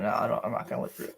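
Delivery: quick, breathy whisper of a refusal. Real, not acted. Streamer refusing to chat, in a fast whisper. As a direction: hiss the refusal under your breath.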